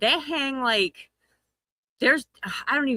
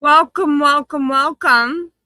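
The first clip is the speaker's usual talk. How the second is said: big booming hello, much louder; loud welcome; 7 semitones higher, 8 dB louder than usual. Real, not acted. Host greeting stream viewers, loud and excited. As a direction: hype up the crowd as they arrive.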